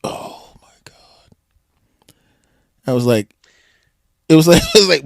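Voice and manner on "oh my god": breathless whispered oh my God; stunned whisper. Real, not acted. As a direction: let it slip out as a hushed gasp. Stunned reaction to a name.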